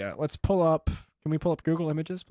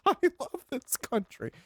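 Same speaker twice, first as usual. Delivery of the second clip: higher, gushing patriot voice; mock teary patriot; 11 semitones higher than usual. Woman at the execution, lightly voiced teary patriot. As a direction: sigh it out with misty-eyed pride. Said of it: "she's just like".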